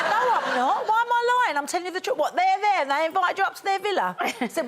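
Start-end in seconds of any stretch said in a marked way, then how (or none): 0.0-2.2 high, loud, insisting it's true; indignant protest. Retold or imitated. Telling her kids she isn't lying, high and loud. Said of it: "I went". 2.2-4.2 put-on disbelieving kids. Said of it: "They went".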